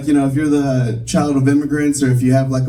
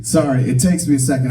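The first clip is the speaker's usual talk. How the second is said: usual speaking voice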